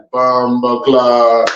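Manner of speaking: low, slow Jamaican drawl; mimicking a Jamaican chef, low and drawn out; imitated Jamaican accent. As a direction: drop deep and stretch it out Jamaican-style. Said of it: "he speaks straight Jamaican".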